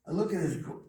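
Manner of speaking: normal talking voice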